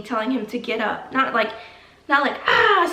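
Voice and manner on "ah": short mock scream, high and loud; high, breathy pretend shriek. Shown as a demonstration. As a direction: imitate screaming with a brief "ah". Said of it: "screaming, but".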